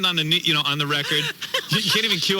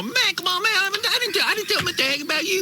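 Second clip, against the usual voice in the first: much higher put-on street voice, pleading; hammed-up homeboy whine; mock urban slang begging. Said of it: "I can't even do it no more".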